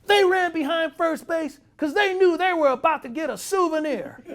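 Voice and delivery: high, loud mock rant through laughter; laughing high shout. Manager mocking Saxy's throw, laughing and shouting high. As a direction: yell it while cracking up. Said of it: "He says".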